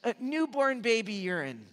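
high, put-on aunt voice, falling at the end; Aunt Peggy. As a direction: deliver the odd remedy in a high mimicking voice. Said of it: "this is how she talked".

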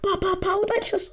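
high, loud ba-ba-ba then the shot; hyped play-by-play of a shot; excited shooting noises. Real, not acted. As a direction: narrate the gunfire with glee. Mimics shooting while playing.

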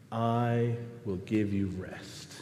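low, soft, slow reassurance; Jesus responding. Acted. Jesus answering a worn-out believer, soft slow reassurance. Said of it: "And in response".

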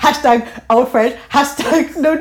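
giggling through the words; laughing. Real, not acted. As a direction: laugh while saying it. Laughing at her own joke.

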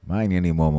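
plain reading voice

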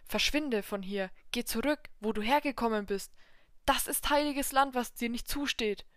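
loud, fast, furious order; angry command. Acted. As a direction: drive him out of the hall. Old god orders devil away.

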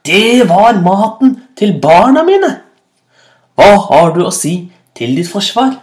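loud, high outraged demand; angry accusation. A narrator's reading. Hyena father accusing the food thief, angrily.

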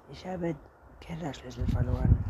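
mildly reluctant statement; hesitant confession. A narrator's reading. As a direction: admit it with a little hesitation. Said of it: "Anton zögerte". (zögerndes Geständnis)

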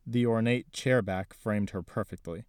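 usual speaking voice

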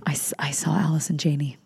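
breathless whisper after a gasp; excited whisper. Real, not acted. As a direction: whisper it in awe.

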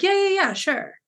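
breezy high-pitched yeah-yeahs, easing down on "sure"; casual agreement. Retold or imitated. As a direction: toss off a casual yes, imitating him. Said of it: "says it so casually like that".

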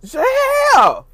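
high, loud yelp of disbelief; shocked exclamation. Real, not acted. Telling viewers about grandma watching, a high scandalised yelp.

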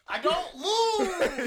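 triumphant shout. Real, not acted. Triumphant boast after winning.

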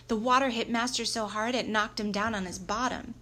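plain reading voice

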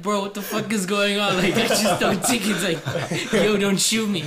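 high, loud mock-panicked protest breaking into laughter; comic panic. Storyteller acting out his terror at the cop, comic panic. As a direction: plead in exaggerated, laughing alarm.

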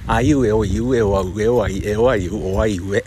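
loud, deliberate vowel drill; articulation drill. Shown as a demonstration. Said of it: "うわー、滑舌悪い".

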